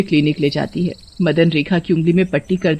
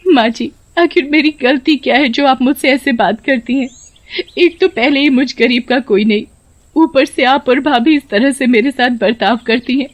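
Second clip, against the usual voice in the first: higher-pitched, plaintive daughter-in-law; wronged daughter-in-law; 6 semitones higher than usual. Acted. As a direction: plead with the mother-in-law over ill treatment. (दुखी बहू की शिकायत भरी आवाज़)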